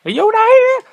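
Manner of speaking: sudden high, loud squeal of Yoda; high, loud cartoon yelp; squeaky Yoda shout. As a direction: blurt it in a tiny alien voice. Random shouted voice in ad.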